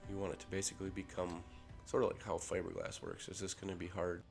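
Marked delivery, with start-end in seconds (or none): none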